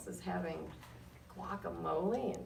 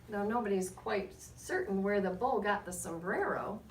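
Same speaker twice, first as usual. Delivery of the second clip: put-on lively read-aloud to children, rising on names; story narration; 4 dB louder, slower than usual. A narrator's reading. Reading to the class with lively storybook narration. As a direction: tell it like a picture book to a class. Reads picture book aloud.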